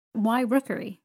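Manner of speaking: loud insistent question; insistent repeat. A narrator's reading. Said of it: "said Miss Betsy".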